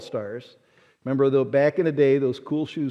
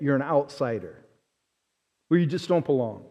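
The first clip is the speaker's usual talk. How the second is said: ordinary talk, nothing marked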